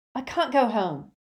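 high, loud protest; shouting. A narrator's reading. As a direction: shout it at your mother. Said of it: "Ginny shouted".

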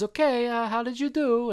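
high, exaggerated fake French accent; hammed-up French accent in a high voice; Rodique. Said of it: "he's doing a very fake French accent".

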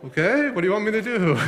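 high, quick comic disbelief; incredulous indifference. Acted. Dad told of a squirrel in the road, incredulous indifference. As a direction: brush off the silly report with amusement. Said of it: "I'd look at you like".

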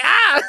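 high-pitched mock yelp of alarm; startled yell. Retelling being rushed by a man, mock startled yell. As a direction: yelp as if caught off guard.

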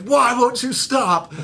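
high, slowed, whiny mock-sob at the car; imitated crying wail, not real tears; retold crying plea. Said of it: "just crying".

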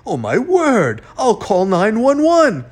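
high, loud shock; alarmed. A narrator's reading. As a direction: exclaim in alarm, voice leaping up. Mayor alarmed at sudden blindness.

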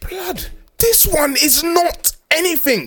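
exasperated retort. Acted. Man thinking the argument is trivial, exasperated. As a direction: stretch it out as an exasperated dismissal.

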